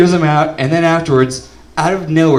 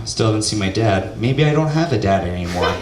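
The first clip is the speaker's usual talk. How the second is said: ordinary talk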